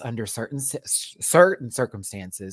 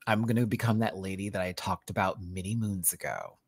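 normal talking voice

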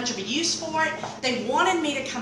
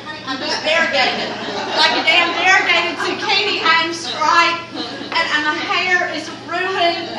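louder, higher comic despair; 7 semitones higher, 6 dB louder than usual. Real, not acted. To the audience about her ruined spray tan, mock despair. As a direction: ham up the disaster.

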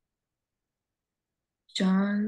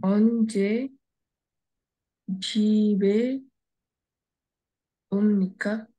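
halting word-by-word reading; faster than usual. Shown as a demonstration. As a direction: sound out each word separately. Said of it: "read it fastly again".

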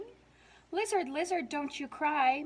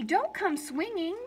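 plain reading voice

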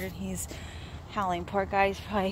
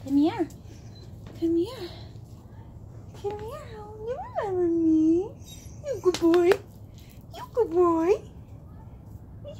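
higher-pitched sweet talk to a dog; 8 semitones higher than usual. Real, not acted. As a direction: coax a shy dog closer in a higher voice. Coaxing a scared dog closer.

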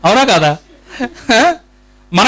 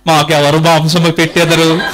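mock crying; 7 semitones lower than usual. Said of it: "ఏడుస్తూ".

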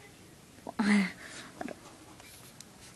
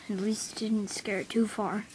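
ordinary talk, nothing marked

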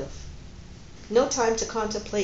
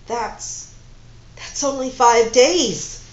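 stricken; 3 semitones higher, 7 dB louder, slower than usual. Acted. Horrified at little time left.

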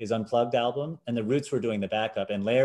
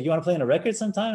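quick eager offer; 8 semitones higher, 3 dB louder, much faster than usual. Retold or imitated. Producer offers a session.

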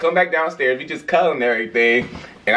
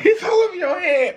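high laughing yell; laughing shout. Real, not acted. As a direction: shout through laughter, much higher. Friend spat drink on carpet.

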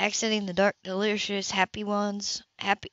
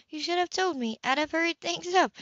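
higher woman's voice, apologetic; Grace, a bit flustered; 8 semitones higher than usual. A narrator's reading. Grace to Ethan, higher and apologetic. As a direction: lift the voice, eager to please.

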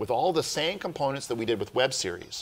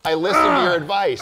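louder, emphatic, hammed-up protest; mock insistence; 7 semitones higher, 6 dB louder than usual. Playfully protesting to his co-host, louder and emphatic. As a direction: insist on LISTEN, half-joking.

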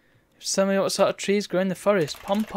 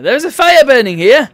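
loud and high exclaim; alarmed reading; 6 semitones higher, 13 dB louder than usual. Acted. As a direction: sound the alarm on the fire. Reads alarming game text.

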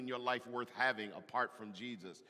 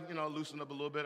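ordinary delivery

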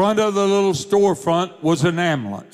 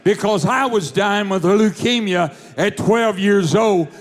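fiery preaching; 4 semitones higher than usual. Acted. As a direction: preach it to the back row. Preaches at full force.

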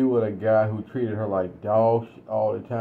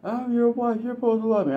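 much higher wounded wife voice; hurt wife; 11 semitones higher than usual. Retold or imitated. Wife protesting to her husband, high and hurt. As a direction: go way up, hurt and indignant. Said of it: "And she's like".

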